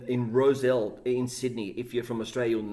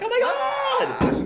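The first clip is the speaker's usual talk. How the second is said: high, slow, drawn-out Oh my God; starstruck gasp; 6 dB louder, much slower than usual. Retold or imitated. Canadians hearing he cooked for the band, high stunned squeal. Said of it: "the Canadians would be like".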